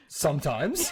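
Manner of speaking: ordinary talk, nothing marked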